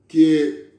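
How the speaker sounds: ordinary delivery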